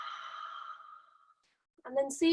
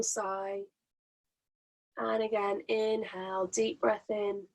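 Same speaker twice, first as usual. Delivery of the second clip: guided breathing. Acted. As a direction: ease them into each breath gently. Leads a breathing exercise.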